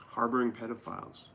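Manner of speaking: normal talking voice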